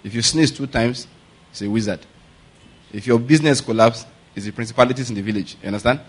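normal talking voice

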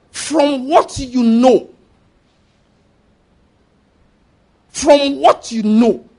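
from what you know said very slowly, word by word; slow emphatic answer. Shown as a demonstration. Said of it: "Power is what?".

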